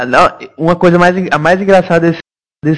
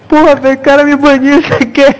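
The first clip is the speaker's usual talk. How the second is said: whiny friend voice; 9 semitones higher than usual. Retold or imitated. Friend complaining he got banned.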